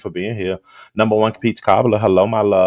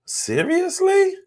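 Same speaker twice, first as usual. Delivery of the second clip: incredulous; much slower than usual. Real, not acted. Exasperated rant at Lemon.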